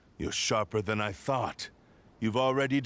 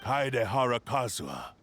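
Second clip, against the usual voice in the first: usual speaking voice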